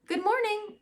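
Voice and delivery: put-on high, bright little-girl greeting; cheery greeting. Beatrice greeting her fans, high cheery voice. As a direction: sing out a cheery hello.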